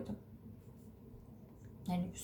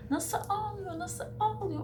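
imitating baby crying; 11 semitones higher than usual. Bebeğin ağlamasını anlatan anne.

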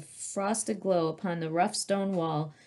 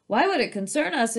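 1 indignant male lion; 6 semitones higher, 4 dB louder than usual. A narrator's reading. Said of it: "the male said".

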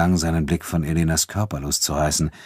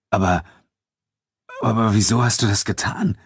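stunned, stammered question at a raised pitch; bewildered, higher-pitched protest; 5 semitones higher than usual. A narrator's reading. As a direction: falter on the first word, then ask in disbelief. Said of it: "murmelte André fassungslos".

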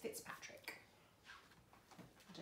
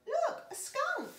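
high, loud, drawn-out cry; excited little boy; 10 dB louder, much slower than usual. Acted. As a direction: call it out like an amazed child. Little boy points out the skunk.

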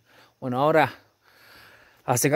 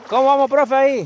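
high loud call across the river; 9 semitones higher, 5 dB louder than usual. Real, not acted. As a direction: shout the question to a friend. Calling out to companion mid-river.

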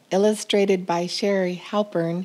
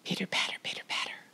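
whispered pitter patter; 13 dB quieter, much faster than usual. A narrator's reading. Narrator whispering the fox sneaking up. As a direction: whisper it like creeping paws.

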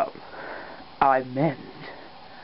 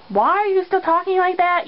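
high, squeaky complaint; challenging bandmate; 11 semitones higher than usual. Acted. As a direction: question it in a much higher voice. High-voiced bandmate challenges accent.

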